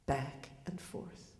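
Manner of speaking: slow, breathy fade to a whisper; hushed closing echo; fading whisper. A narrator's reading. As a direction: let it drift away like the swing. Closing image repeated, fading.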